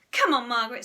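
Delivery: loud coaxing call; urging. A narrator's reading. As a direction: urge a friend along. Susan urging Margaret to leave.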